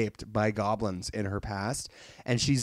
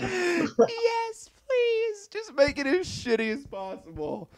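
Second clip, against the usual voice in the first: very high, thin pleading voice that fades softer; squeaky mock plea; 4 dB quieter than usual. Podcaster begging cohost for a shitty edit, squeaky high plea. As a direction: beg like a cartoon in a tiny squeak.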